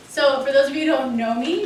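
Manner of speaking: loud, carrying voice to address a crowd; booming teacher voice over a busy room; big teacher voice. Real, not acted. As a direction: project like a teacher calling a room to order. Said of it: "I have the teacher voice".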